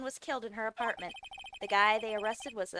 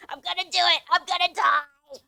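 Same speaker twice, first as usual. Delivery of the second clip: wailing; 7 semitones higher, 9 dB louder than usual. Acted. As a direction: moan like a hopeless wreck. Larry wails he'll die.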